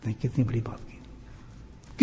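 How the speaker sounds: slow breathy hush, almost whispered; hushed awe. Acted. Preacher hushed in awe at the warning. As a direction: let it sink in, barely voiced. Awed aside.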